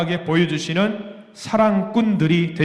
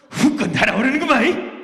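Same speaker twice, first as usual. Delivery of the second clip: higher, playful Jeolla dialect catchphrase; 5 semitones higher than usual. Shown as a demonstration. Preacher recalls a comedy sketch's Jeolla catchphrase for love, playful. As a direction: toss off the Jeolla line like a comedian. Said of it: "이거를 뭐냐면 이렇게 말해요".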